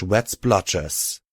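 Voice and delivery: plain reading voice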